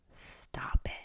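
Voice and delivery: breathy whispered dismissal; whispered scoff. Real, not acted. To viewers, scoffing at the idea, whispered. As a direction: brush it off in a whisper.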